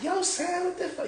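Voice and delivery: squeaky-high mock of an angry friend; high-pitched imitation. Storyteller mimicking his mad homeboy, high-pitched. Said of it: "He talked real, you know, high pitch".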